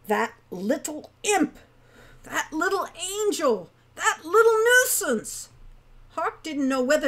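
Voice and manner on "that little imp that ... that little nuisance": exasperated outburst, climbing higher and louder. A narrator's reading. Hawk fuming over little Wren, exasperated, building. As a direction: build to a high, loud cry of frustration. Exasperated Hawk's thoughts in story.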